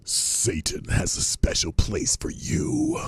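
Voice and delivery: eerie high whisper, drawn out; high unvoiced sinister whisper; spooky Satanist line. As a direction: breathe it like a creepy cultist. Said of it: "that last line by the Satanist".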